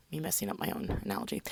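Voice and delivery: quick laughing whisper; whispered aside. Real, not acted. Whispering about messing up her own analogy. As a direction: mutter it to yourself, amused.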